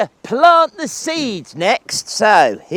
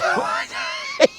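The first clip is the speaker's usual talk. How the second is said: high falsetto cackle; 8 dB quieter than usual. Acted. Grandad's high laugh.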